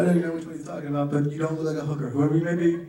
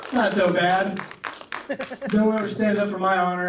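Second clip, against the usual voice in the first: ordinary delivery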